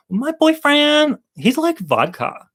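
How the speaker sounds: put-on high, slow girl voice starting up; third girl. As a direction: lift it up coy and slow. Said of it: "the third girl says".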